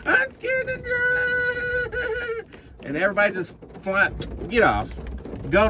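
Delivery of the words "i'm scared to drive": very high, slow, whiny crying mock; mocking a scared driver. Trucker mocking a timid driver, whiny fake crying. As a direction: whimper it like a scared baby. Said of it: "and you're just like".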